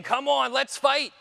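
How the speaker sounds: aggressive challenge. Retold or imitated. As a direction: goad someone into a fight, acted out. Joe challenges him to fight.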